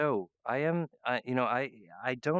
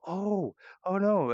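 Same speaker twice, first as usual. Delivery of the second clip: alarmed oh no; 8 semitones higher, 5 dB louder, slower than usual. Real, not acted. Sudden alarm at the headline.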